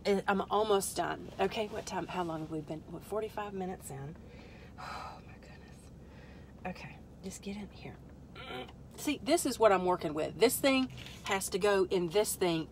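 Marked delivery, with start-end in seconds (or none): 4.8-7.9 unvoiced, exasperated whisper. Real, not acted. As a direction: breathe it out in frustration. Frustrated muttering at straps.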